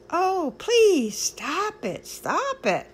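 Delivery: high, loud pleading that fades and slows; pleading cry. Acted. The teacup begs the master to stop the painting, pleading. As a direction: beg him to stop, half in tears. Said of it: "I cried".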